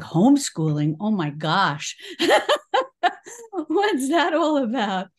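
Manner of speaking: loud, startled "Homeschooling?" then laughing disbelief; retold shocked surprise. Mother hearing the teacher suggest homeschooling, stunned and laughing. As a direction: replay your own stunned reaction, half laughing. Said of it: "And I'm like".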